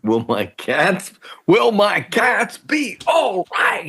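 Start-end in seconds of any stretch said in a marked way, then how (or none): 1.5-3.9 high, excited shouted call; home run call. Pretend. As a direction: belt it like a ballpark announcer. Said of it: "this could almost be a home run call".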